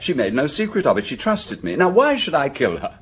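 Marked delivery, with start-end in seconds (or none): 1.8-3.0 indignant protest. Acted. Suspect protesting his innocence.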